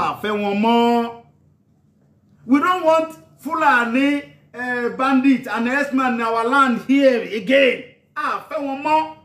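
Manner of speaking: loud, slow and emphatic, each word weighed; slow defiant declaration. Real, not acted. Activist addressing Yoruba people, slow loud defiant declaration. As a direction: hammer home the rejection, word by word.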